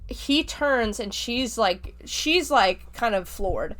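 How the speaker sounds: ordinary talk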